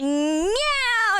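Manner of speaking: long, high imitation meow; drawn-out mimicked cat cry; loud cat meow. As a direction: yowl like a cat meowing his head off. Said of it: "He's such a loud boy".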